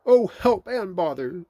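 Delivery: slow, high, loud wail; dismayed cry. A narrator's reading. Pooh wedged in the doorway, dismayed. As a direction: cry out in storybook distress.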